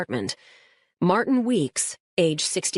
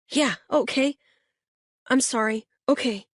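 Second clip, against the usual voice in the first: lightly voiced boy, eager, pitched higher; a kid pointing out the house, keen; Luis, excited boy; 7 semitones higher than usual. A narrator's reading. Boy in the back seat guiding the officer, eager.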